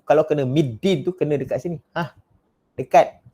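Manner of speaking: ordinary delivery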